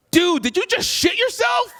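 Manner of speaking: very high, drawn-out shocked question; incredulous outburst. Retold or imitated. Felon to his friend who froze at the shots, incredulous high shout. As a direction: imitate an appalled friend, voice leaping way up.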